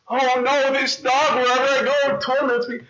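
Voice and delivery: high, whining frightened cat; whiny cat. Acted. Cat complaining to the wizard about the dog, high and whiny. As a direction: whimper it like a put-upon cat. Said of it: "this cat that was a mouse says".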